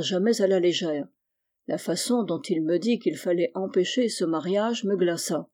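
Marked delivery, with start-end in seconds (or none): none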